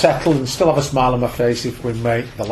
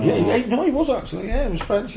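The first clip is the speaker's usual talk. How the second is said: put-on Welsh accent; 10 semitones higher than usual. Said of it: "I did say it with a Welsh accent".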